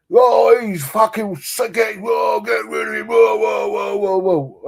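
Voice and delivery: high shouted protest, falling away to near normal on the last whoas; outraged terrace shouting; mock fan outburst. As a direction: ham up a raging fan who wants him sent off. Fans shouting at a sending off.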